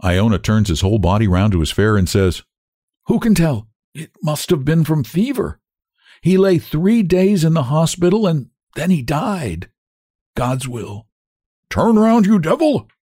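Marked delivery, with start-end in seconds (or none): 3.1-11.1 sorrowful, resigned telling; grieving cabman. A narrator's reading. Grieving cabman explains his son's death. 11.7-12.9 shout from darkness. A narrator's reading. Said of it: "comes out of the darkness".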